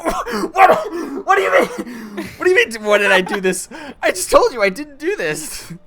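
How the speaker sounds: high, loud, squeaky disbelief; incredulous. Retold or imitated. Retelling denying it to the boss, high incredulous squeak. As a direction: protest your innocence, shocked. Confused denial quoted to boss.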